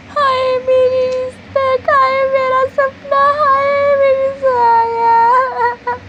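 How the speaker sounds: high, loud, slow hammed-up crying; exaggerated sobbing over lost honour; mock wailing lament. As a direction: drag out each हाय like a melodrama heroine. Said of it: "आप क्यों रो रहे हो?".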